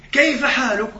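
high, loud bedside question. Acted. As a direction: ask it loudly, acting a deaf visitor. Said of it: "وأقول له بصوت مرتفع".

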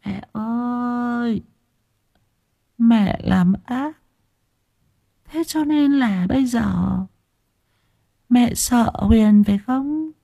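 the ghost daughter softly accuses her mother; soft eerie child. Acted. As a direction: coax her into admitting guilt. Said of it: "Giọng của nó thẽ thọt".

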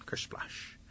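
soft unvoiced "kersplash"; imitated splash. Rabbit throws rocks at the pond, splash noise. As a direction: whoosh it like water.

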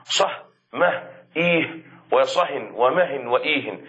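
صح، مه، إيه said slowly without tanween, then صهن، مهن، إيهن with final n; teacher spelling out صح and صهن in turn. Shown as a demonstration. Teacher to students, contrasting tanween forms slowly. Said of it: "بالتنوين وبغير تنوين".

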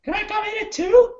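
very high mock female voice; imitated woman caller. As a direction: squeak it like a woman on a call. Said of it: "she's like".

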